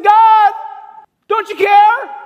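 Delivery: loud, high desperate plea; anguished cry to God. Retold or imitated. Pastor recalling his cry to God, loud anguished plea. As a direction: cry out to heaven, almost shouting.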